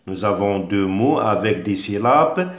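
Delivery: normal talking voice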